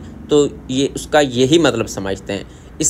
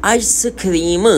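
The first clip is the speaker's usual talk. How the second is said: आइसक्रीम said slowly in a Korean accent, drawn out as aisa-krim; 4 semitones higher, slower than usual. Shown as a demonstration. Teacher demonstrating the Korean way to say आइसक्रीम, Korean accent. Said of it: "Korean लहजे में बोलेंगे आइसक्रीम".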